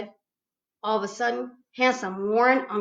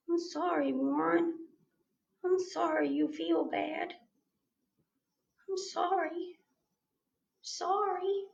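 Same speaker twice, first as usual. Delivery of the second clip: softer sorry, gently; 7 semitones higher, 7 dB quieter than usual. A narrator's reading. Girl softly comforting the crying boy, quiet and tender. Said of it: "I said real soft".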